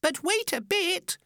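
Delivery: very high, tiny creature voice; squeaky little oysters protesting; crying oysters. A narrator's reading. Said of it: "the oysters cried".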